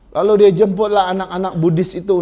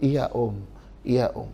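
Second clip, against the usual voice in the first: respectful reply; 5 semitones lower, 6 dB quieter, slower than usual. Retold or imitated. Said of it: "dia tetap hormat".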